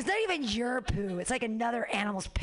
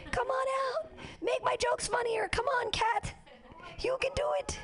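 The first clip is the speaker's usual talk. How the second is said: much higher coaxing call to the cat. Real, not acted. Comic coaxing her cat out of hiding, high coaxing call. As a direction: coax the cat out in a sing-song high voice. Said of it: "I'm trying to call the cat".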